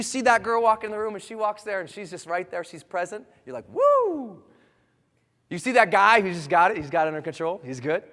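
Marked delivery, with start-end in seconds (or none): 3.7-4.4 high, loud drawn-out woo; admiring whoop. Acted. Reaction to an attractive, confident person.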